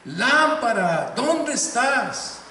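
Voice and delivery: loud, slow call to the lamp; said strongly. Shown as a demonstration. Grandfather showing the boy how to call the lamp, loud and slow. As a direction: call it out strong with eyes closed. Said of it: "fuertemente decir".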